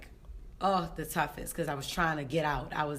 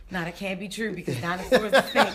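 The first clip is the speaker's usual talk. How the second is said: laughing disbelief; 4 semitones higher, 6 dB louder than usual. Retold or imitated. Hallucinating dinosaur, laughing.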